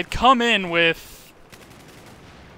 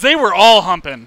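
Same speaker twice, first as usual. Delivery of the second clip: loud insisting; 10 dB louder than usual. Real, not acted. Insisting to friends about bunnies.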